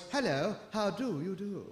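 a hint of mock-posh manners. Shown as a demonstration. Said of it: "say in their poshest voice".

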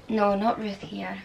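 normal talking voice

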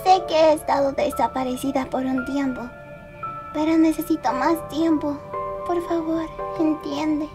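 soft, withdrawn apology; subdued plea. Acted. Pleading for more time after disappearing.

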